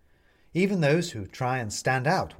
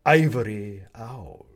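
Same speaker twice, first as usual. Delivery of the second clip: "ivory" loud, slow and drawn out; stress on IVORY; 7 dB louder, slower than usual. A narrator's reading. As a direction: lean pointedly on the word "ivory". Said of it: "the owl is stressing the word ivory".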